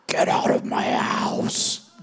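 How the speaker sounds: eerie breathless whisper building to a slow rasping shout; ghostly whisper. Retold or imitated. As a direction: play the ghost on the tape, hushed then menacing. Ghost voice on the recorder.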